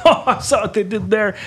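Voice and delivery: high, chuckling imitation of a listener; amused audience member. Imitates audience hearing the joke twice, amused high voice. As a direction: pitch it up with a laugh. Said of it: "they're like".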